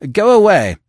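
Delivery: very loud, high-pitched shout. A narrator's reading. Editor dismisses a messenger at the door, shouting. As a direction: bellow it through the door. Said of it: "shouted the editor".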